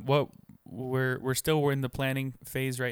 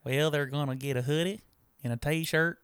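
Texas twang, playing the co-host; mock southern accent; put-on southern accent; 2 semitones higher than usual. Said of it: "give you an excuse to talk with an accent".

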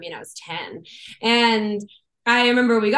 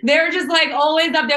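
laughing; 7 semitones higher, 5 dB louder than usual. Real, not acted. Laughing about the Beatles.

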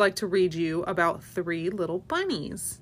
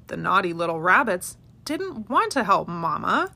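plain reading voice

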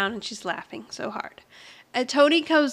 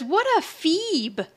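slow, higher, drawn-out scorn; scornful quote; 6 semitones higher, much slower than usual. Retold or imitated. Podcaster quoting Jonathan's insult, slow and scornful. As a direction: stretch out the insult, mock-disdainful. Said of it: "Jonathan says".

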